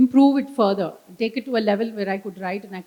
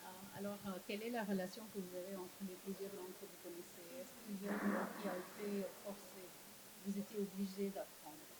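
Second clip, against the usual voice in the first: soft, quick whisper close to the ear; whispered interpreting; 25 dB quieter, faster than usual. Real, not acted. Interpreter murmuring the audience question to the author, hushed whisper. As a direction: murmur the translation so only he hears.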